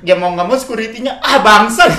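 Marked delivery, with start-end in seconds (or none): 1.2-1.9 loud mock outburst with laughter; retold exasperated curse. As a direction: curse as the fed-up guard, amused. Fed-up security guard's curse, retold. (umpatan kesal sambil ketawa)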